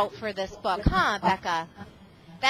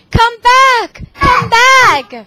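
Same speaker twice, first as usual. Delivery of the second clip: loud high calling out; chasing couple yelling loudly; 10 semitones higher, 13 dB louder, much slower than usual. Acted. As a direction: holler after the runaway cookie.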